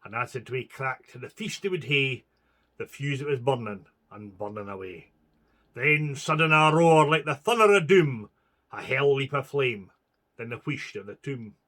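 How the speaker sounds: ordinary delivery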